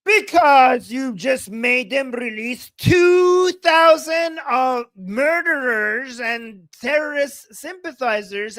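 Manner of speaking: loud, slow, indignant shout; angry shout. Real, not acted. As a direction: hammer it out at Trump, furious. Angry rant at Trump.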